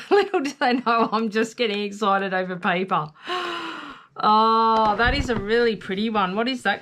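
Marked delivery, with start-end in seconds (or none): 3.1-3.9 soft delighted gasp. Real, not acted. Gasp at pretty paper.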